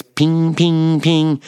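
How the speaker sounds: high, ringing "ping" sounds; imitated pinging. A narrator's reading. Patient echoing a pinging in the hospital stall, high imitation. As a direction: imitate a small high repeating alert tone. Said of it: "I hear a pinging".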